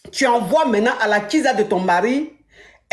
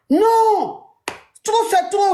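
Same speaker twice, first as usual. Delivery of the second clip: outburst; 8 semitones higher, much slower than usual. Retold or imitated. Cri de la femme excédée. (crie non, aigu et fort, à bout)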